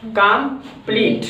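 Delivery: Complete split as "कम - pleat"; broken into syllables. Shown as a demonstration. Teacher proving the word takes two beats, complete broken into syllables. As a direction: clear pause between कम and pleat in complete. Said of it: "दो बार में बोला है".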